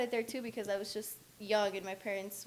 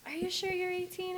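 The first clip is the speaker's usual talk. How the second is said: suspicious tattoo artist; 10 semitones higher than usual. Retold or imitated. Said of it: "the guy was like".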